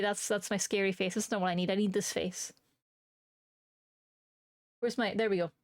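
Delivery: ordinary talk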